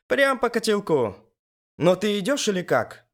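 high, exasperated female character voice. A narrator's reading. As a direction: hurry him along in high indignation. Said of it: "ещё сильнее возмутилась Элис". (возмущённо, высоким голосом)

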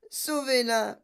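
high-pitched motherly wail; alarmed cry. A narrator's reading. Mother seeing her daughter attacked, alarmed cry. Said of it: "hurla madame Salt".

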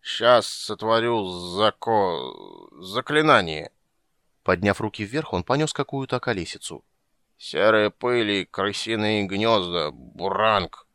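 put-on slow drunken slurring; slurred speech. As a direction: struggle to get the words out. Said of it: "с трудом выговаривая самые простые слова".

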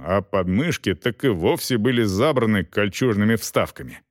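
usual speaking voice

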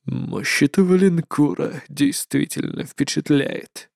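low, slow menacing voice; cold dark overlord; shadow lord. Acted.

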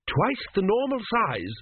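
high, slow blurted demand; desperate. A narrator's reading. Young king tries to outbid the priest, desperately. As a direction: push it out high, grasping for control. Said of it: "said Teppic desperately".

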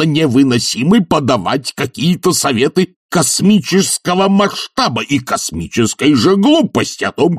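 high, sustained scolding; outraged tirade. Acted. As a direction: berate indignantly, stretching the key insults. Professor's furious lecture continues.

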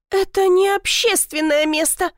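high-pitched, mildly shouted objection; indignant cry. A narrator's reading. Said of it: "крикнула она". (возмущённый выкрик)

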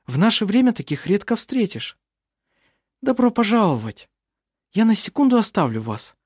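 put-on high, bubbly girl voice; bright chatter of a lively girl; lively young woman voice. A narrator's reading. Narrator voices Vinga.

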